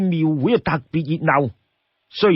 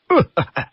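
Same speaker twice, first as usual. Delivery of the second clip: put-on short breathy old man's chuckle; brief amused old-timer's laugh; 3 semitones higher than usual. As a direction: chuckle like a genial old man. Old man chuckles before answering.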